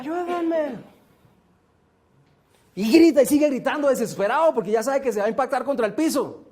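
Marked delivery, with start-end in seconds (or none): 0.0-0.8 high, softer echo of the plea; fading echo from the canyon walls. Retold or imitated. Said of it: "va a escuchar". (eco agudo y más suave)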